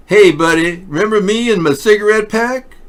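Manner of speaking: high, loud falsetto imitation; mock woman greeting. Host voicing the woman tracking down the father, high and loud. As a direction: squeal it out like she's cornering him. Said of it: "you go".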